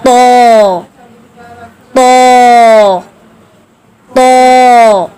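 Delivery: तो heavy and drawn out, said loudly; heavy long to. Shown as a demonstration. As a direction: stretch a full heavy तो. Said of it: "खड़ा ज़बर भी मोटा कर देंगे". (भारी लंबा तो)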